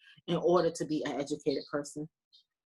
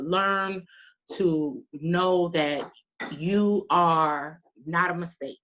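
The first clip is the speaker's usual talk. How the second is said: ordinary talk, nothing marked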